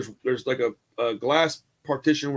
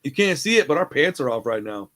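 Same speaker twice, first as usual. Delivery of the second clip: normal talking voice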